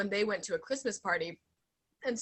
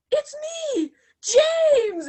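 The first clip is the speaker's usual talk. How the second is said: excited; 10 dB louder, much slower than usual. Retold or imitated. Stranger introduces himself loudly.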